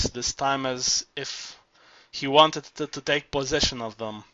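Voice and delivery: ordinary delivery, nothing marked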